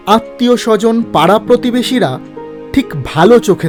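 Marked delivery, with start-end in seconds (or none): none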